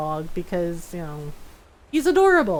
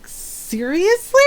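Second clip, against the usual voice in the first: drawn-out, high incredulous question; slow rising disbelief, pitched well up; 8 semitones higher, much slower than usual. Retold or imitated. Owner reacting to pets pawing at the bathroom door, incredulous.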